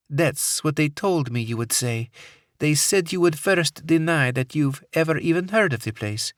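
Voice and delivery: put-on high, taunting Middle Eastern accent; a cold captor who enjoys the threat; menacing woman, Middle Eastern accent. Captor voice held.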